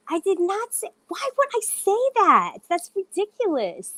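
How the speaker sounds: high-pitched, indignant denial; incredulous protest. Real, not acted. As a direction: pitch leaps up in disbelief at being accused. Protesting a false accusation.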